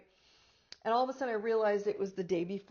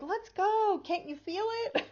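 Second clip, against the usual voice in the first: much higher, louder urging; egging yourself on impatiently; pushy excited mind; 11 semitones higher, 4 dB louder than usual. Acted. The mind urging the body onward, higher and excited. Voicing the mind pushing forward.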